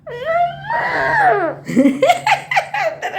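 a long helpless laugh; long high laugh. Real, not acted.

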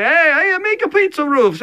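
high, loud, hammed-up Italian accent; bad Italian accent. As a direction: do a badly faked Italian pizza maker. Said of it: "I'm doing another bad accent".

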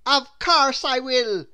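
a kind postman agreeing. Acted. Postman agreeing to ring the bell for him.

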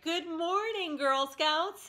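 high, loud announcer greeting; cheery loudspeaker wake-up; loudspeaker wake-up call. Retold or imitated. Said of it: "it was just like".

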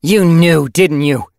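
loud, high, accusing snarl; snarling demand. Acted. Yellow Fang confronts Silver Flame about hidden knowledge, snarling loudly. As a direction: throw the accusation right at her. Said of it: "demanded with a snarl".

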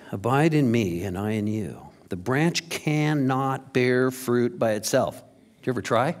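ordinary talk

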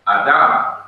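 "Adam" with Hebrew final stress; stress on last syllable. Shown as a demonstration. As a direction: land firmly on the second syllable of "Adam". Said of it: "when a syllable is stressed".

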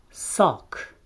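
sock with a crisp S; English sock. Shown as a demonstration. Teacher modelling English sock, crisp S. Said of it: "ca un S românesc".

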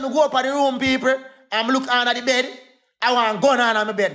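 normal talking voice

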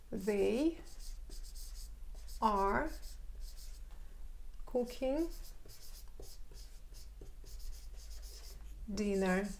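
word by word with long pauses; slow teacher demo; slow dictation. Shown as a demonstration. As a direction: dictate it slowly for notes. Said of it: "teacher models English slowly".

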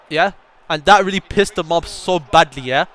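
ordinary delivery

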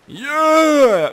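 loud, high drawn-out yell; sudden yell. Real, not acted. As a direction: let out a big high holler. Yells as the game glitches.

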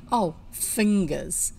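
slow, drawn-out mild sneer. Acted. Sister scoffing at her brother, slow sneer. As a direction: scoff at his excuse, slowly. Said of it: "sneered Anthea through her sniffs".

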